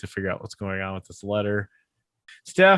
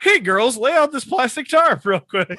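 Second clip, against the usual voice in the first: much higher, louder mock creepy dad; mock Danny voice; 13 semitones higher, 6 dB louder than usual. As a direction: sing it out like a cheery killer dad. Danny voiced, joke about bodies.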